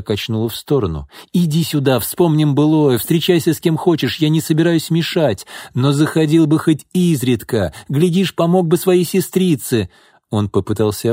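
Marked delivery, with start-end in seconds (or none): 1.3-9.9 high, pressing plea; insistent coaxing. A narrator's reading. Pyotr tries to embrace departing Alexei, insistent coaxing. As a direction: keep pushing, pitch raised all through.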